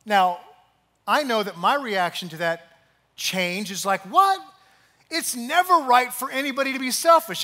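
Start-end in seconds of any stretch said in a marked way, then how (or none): 4.0-7.4 high, loud indignant quote; mock outrage. Preacher mocking the room's outrage, indignant. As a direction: act shocked at the very idea.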